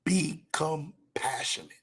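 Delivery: slow whispered "Be compassionate", each word breathed out. Real, not acted. Repeats the rule, whispered.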